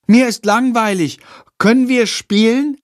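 put-on high, pleading child voice; begging little brother. A narrator's reading. Tom pestering his big brother to play, begging. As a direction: beg like a bored small boy. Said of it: "bettelt Tom".

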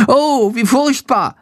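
high, slow, horrified little bat; horrified cry. A narrator's reading. Shandor the bat sees the dead bat, high horrified cry. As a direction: gasp it out in shock, drawn out. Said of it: "ruft er entsetzt".

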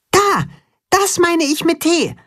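squeaky little animal voice; high child voice. A narrator's reading. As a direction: pitch it way up. Max's high character voice.

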